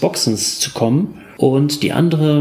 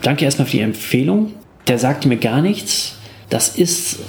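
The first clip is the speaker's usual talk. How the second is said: ordinary delivery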